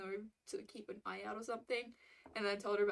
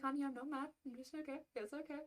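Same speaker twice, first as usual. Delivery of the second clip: soft, high-pitched soothing; reassuring; 5 semitones higher, 6 dB quieter than usual. Retold or imitated. Customer telling a sorry server it's okay, reassuring. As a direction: gently soothe in a raised, soft voice.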